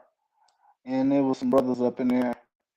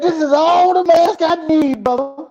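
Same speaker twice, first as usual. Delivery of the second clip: loud, high mock preacher proclaiming; 14 semitones higher, 10 dB louder than usual. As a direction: boom it like a preacher with a Bible. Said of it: "and said".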